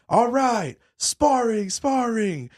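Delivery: high, drawn-out gym announcement; mimicking an instructor's high holler; imitated coach call-out. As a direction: call out the round like a coach across the mat. Retold instructors calling out sparring round.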